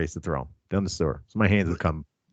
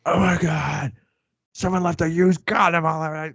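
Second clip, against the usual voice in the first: shocked; 9 semitones higher than usual. Retold or imitated. Friend voiced running in, shocked.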